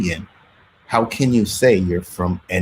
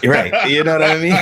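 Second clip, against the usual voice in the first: high, loud words through laughter; laughing agreement; 10 semitones higher, 5 dB louder than usual. Real, not acted. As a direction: crack up while agreeing. Laughing at remark about his looks.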